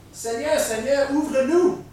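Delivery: high pleading cry to be let in; pleading call. Acted. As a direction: call out to the Lord at the shut door. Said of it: "les autres jeunes filles vinrent et dirent".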